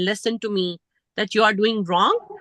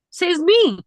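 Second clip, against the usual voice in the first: snapped retort; 8 semitones higher, 6 dB louder than usual. Retold or imitated. Father-in-law's retort.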